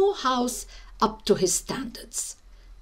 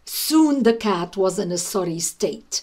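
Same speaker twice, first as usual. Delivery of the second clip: ordinary delivery, nothing marked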